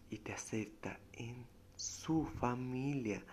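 slow hushed whisper. Acted. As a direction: drop to an intimate whisper. Sudden whispered line in sermon. (susurro lento e íntimo)

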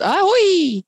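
long, high-pitched bird cry; raw bird screech. A narrator's reading. Gull screeching over the courtyard, raw loud cry. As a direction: let out a soaring screech. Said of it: "klonk er een rauwe, harde schreeuw".

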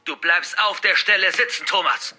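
high, loud teacher's command; forceful scolding shout; shouted order. Acted. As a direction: yell at a pupil to stay put. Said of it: "schrie jetzt auch Frau Rose".

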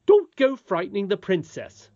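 high, loud reproof; scolding. Acted. Nurse protecting the princess from the boy, scolding. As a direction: tell him off as a nurse.

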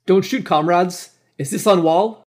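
high, loud plea to comrades; pleading call. A narrator's reading. Wounded soldier to his own sentries, pleading.

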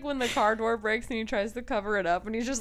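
ordinary talk

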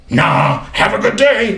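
high rough voice with a laugh in it; imitating Mark, laughing. Mark refusing food from the pantry, rough voice through laughter. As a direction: brush off the offer cheerfully. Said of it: "he had this rough voice".

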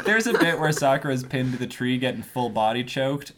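usual speaking voice

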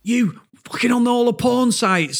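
high-pitched mock accusing outburst; slanging-match accusation. Director accusing employee of porn browsing, retold indignant outburst. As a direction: imitate an outraged boss. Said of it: "a bit of a slanging match".

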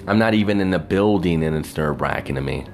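ordinary delivery

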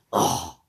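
mock growl. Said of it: "I was about to growl and moan".

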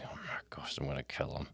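whispered under the breath, exasperated; breathy whispered exasperation. Retold or imitated. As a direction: hiss it to yourself like a frustrated dad in a meeting. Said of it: "and I'm like".

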